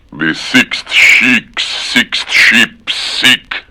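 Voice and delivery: slow, careful syllable by syllable. Shown as a demonstration. Slowly attempting the hardest twister. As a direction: creep through it carefully.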